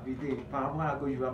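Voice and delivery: ordinary talk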